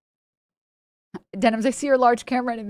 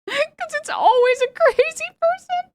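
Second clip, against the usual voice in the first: laughing; 4 dB louder than usual. Real, not acted. Laughing about crazy posters.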